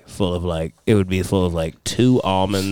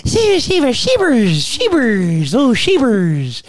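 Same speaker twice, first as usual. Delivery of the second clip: high, loud, drawn-out squealed pet calls; doting dog-lover uncle; 14 semitones higher, 4 dB louder, much slower than usual. Retold or imitated. Nephew mimics uncle petting the dog for hours, high gushing squeal. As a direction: imitate someone gushing shrilly over a dog.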